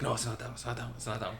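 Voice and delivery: quiet dismissive repeats. Retold or imitated. Quoting friend dismissing each ride.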